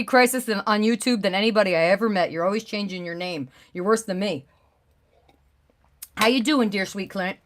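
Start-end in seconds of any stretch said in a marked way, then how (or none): none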